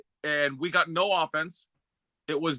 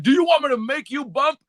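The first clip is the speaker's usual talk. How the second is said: raised, loud and high threat; threatening shout; 9 semitones higher, 5 dB louder than usual. Retold or imitated. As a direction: bark the threat at the kid. Bubba threatening.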